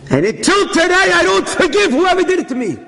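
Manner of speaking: bitter grievance. Retold or imitated. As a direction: voice the wounded father refusing to forgive. Father recalls his grievance bitterly.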